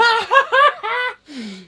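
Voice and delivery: high helpless laugh; burst of laughter. Real, not acted. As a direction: lose it mid-sentence. Cracks up telling story.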